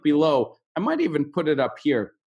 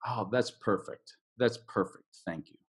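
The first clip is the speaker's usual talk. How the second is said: soft example line; 5 semitones lower, 9 dB quieter than usual. Shown as a demonstration. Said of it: "That's the adjective".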